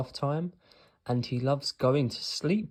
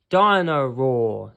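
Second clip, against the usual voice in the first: much louder, slow, thrilled "Dino-Roar"; excited; 11 dB louder, slower than usual. A narrator's reading. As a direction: savour the toy's name, bursting with delight.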